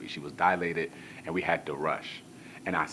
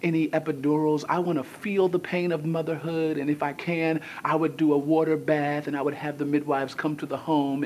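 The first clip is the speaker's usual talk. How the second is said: dreamy wife; 10 semitones higher, 5 dB louder than usual. Retold or imitated. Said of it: "And Anna was like".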